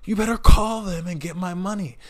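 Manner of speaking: mock yell, ordering staff around; imitated yelling boss. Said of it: "he would just yell and scream".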